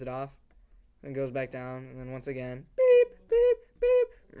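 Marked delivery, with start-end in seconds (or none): 2.8-4.4 loud, very high electronic beeps; microwave beep imitation. Telling how the microwave went off at night, high mimicked beeping. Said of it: "like, the microwave go off".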